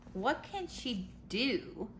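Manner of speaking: usual speaking voice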